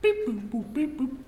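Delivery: high sung phone beeps; high mock dialling beeps; imitated dial tones. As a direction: make the keypad noises with your voice. Imitating phone keys while dialling.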